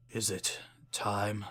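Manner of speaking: soft, fading murmur; faint dying voice. Acted. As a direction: let the words die away. Dying king to himself.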